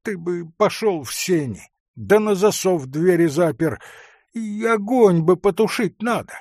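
high, pleading whine; tearful voice. A narrator's reading. Forester begs hunter to bolt the door, tearfully. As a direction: beg anxiously, a touch tearful. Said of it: "сказал он плачущим голосом".

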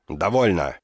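sharp, loud angry outburst; furious shout. A narrator's reading. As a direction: bark it out in fury. Blind king leaps up, furious.

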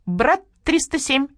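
high, flat radio-operator drone; a starling parroting a radio call in a high monotone. Acted. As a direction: repeat it on one high note like a relay. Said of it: "На одной ноте повторил Скворец".

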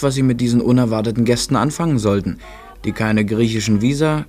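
ordinary delivery

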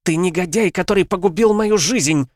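high, loud furious accusation; a wife cursing her husband, high and loud; angry. A narrator's reading. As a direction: hurl the insult at him. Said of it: "со злостью произнесла Лариса".

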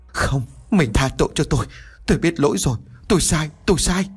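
crying plea. A narrator's reading. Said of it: "ôm mặt mà khóc".

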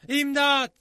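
high, loud cry for help; desperate shout. A narrator's reading. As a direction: call out helplessly at the top of the voice. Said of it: "diye bağırdım çaresizce".

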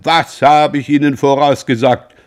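high, loud proud boast; beaming triumph. A narrator's reading. Beausire boasting to the ladies about his course, beaming loud. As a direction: crow about being right. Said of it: "wiederholte strahlend".

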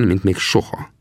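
usual speaking voice

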